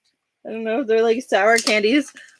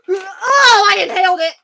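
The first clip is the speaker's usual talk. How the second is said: laughing; 8 semitones higher, 4 dB louder than usual. Real, not acted. Laughing through spicy burn.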